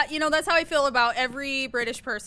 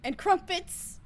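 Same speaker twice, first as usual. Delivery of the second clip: high-pitched mock British accent; hammed-up British accent; 8 semitones higher, slower than usual. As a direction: toss it out in silly British. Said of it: "That's my British accent".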